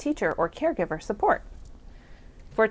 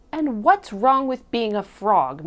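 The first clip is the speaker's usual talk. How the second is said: louder, affronted frogs; indignant exclamation; 3 semitones higher, 6 dB louder than usual. A narrator's reading. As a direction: exclaim it as a challenge to the others. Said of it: "exclaimed the frogs".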